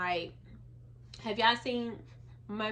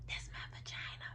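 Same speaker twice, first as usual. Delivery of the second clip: whisper; 12 dB quieter than usual. Real, not acted. Secret word for vagina.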